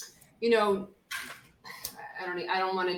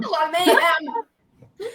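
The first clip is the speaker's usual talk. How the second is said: laughing; 11 semitones higher, 6 dB louder, slower than usual. Real, not acted. Joking about a chaotic show.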